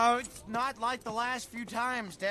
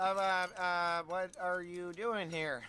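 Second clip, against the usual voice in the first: normal talking voice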